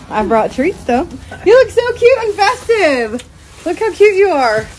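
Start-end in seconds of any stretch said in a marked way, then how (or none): 1.4-4.7 higher, slow, doting coo; cooing over cute costume. Real, not acted. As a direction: gush over how cute she looks.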